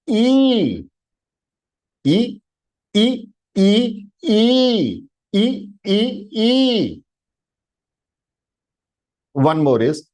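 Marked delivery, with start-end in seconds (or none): 0.1-0.8 E held long and high as "eeee"; triple-length vowel. Shown as a demonstration. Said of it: "again three". 2.0-7.0 E built up in steps, short to long "eeee", higher pitched; triple-length vowel. Shown as a demonstration. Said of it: "again three".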